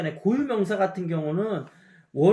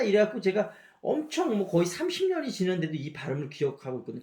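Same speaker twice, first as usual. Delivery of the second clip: ordinary delivery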